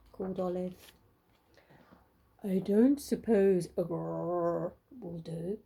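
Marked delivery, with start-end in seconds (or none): 2.4-5.6 slow, low, big bear voice; grizzly bear asking. A narrator's reading. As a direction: rumble it slowly like a huge bear. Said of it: "asked the great big grizzly bear".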